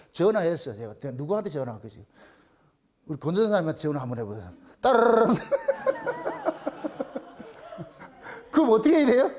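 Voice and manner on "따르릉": loud trilled ringing noise; be the telephone, ringing loudly; imitated phone ring. Shown as a demonstration. Pastor playing a phone call to the congregation, as a loud ring.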